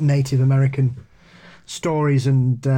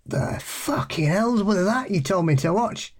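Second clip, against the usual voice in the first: outraged pub friend; 10 semitones higher than usual. Retold or imitated. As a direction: play the friend baffled and indignant, pitched well up. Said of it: "he came in, he went".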